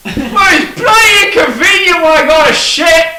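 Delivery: high, loud Cockney yell; rowdy Cockney heckler bellowing; Cockney heckle. Acted. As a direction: shout back like a rowdy Cockney. Crowd member yells back.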